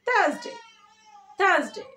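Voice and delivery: high, loud, short "THAAZ-day"; no r in থার্সডে, z before day; smart pronunciation. Shown as a demonstration. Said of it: "smartly কি বলবো?".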